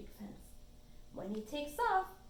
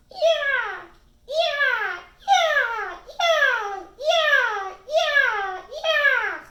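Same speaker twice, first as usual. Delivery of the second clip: loud, high falling squawks; piercing high gull calls; gull cry; 13 semitones higher, 16 dB louder, much slower than usual. A narrator's reading. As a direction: screech a warning like a seagull. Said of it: "The gull warns".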